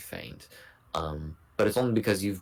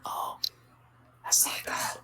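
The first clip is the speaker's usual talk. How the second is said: whispering. Real, not acted. Players whispering during stealth scene.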